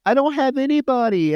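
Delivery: put-on high, pleading girl's cry; imitating a daughter begging in tears; desperate pleading. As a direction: beg her mother not to go, voice up high.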